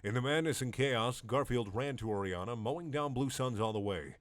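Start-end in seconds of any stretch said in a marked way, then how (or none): none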